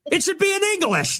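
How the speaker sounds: very high, put-on indignant voice; mock complainer. Host giving audience the take they want, high mocking indignation.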